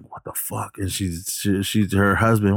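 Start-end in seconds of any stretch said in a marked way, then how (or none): 0.0-0.8 very soft, under-the-breath imitation; hushed alarm. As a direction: act out a scared wife, barely audible. Retold scared wife hearing noises.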